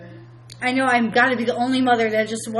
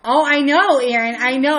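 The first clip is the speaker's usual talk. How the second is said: emphatic agreement; 4 semitones higher, 9 dB louder than usual. Real, not acted. Answering a viewer's comment.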